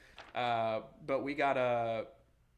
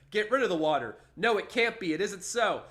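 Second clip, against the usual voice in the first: raised, louder mock alarm; acted panic; 6 semitones higher, 6 dB louder than usual. Reading a man's drowning vision aloud, acted panic. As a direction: read it as a frantic, hammed-up protest.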